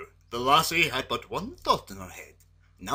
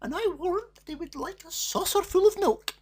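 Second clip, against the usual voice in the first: scolding mother; 4 dB louder than usual. A narrator's reading. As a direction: chide the daughter kindly. Mother voice continues.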